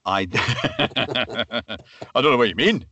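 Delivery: playful, lightly acted police character; Brian Scott. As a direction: answer in character as a chummy detective sergeant. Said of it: "a very specific image, um, for Brian Scott".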